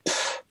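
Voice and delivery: short dismissive pfft; scoff. Retold or imitated. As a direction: blow out air in contempt. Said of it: "scoffing at him".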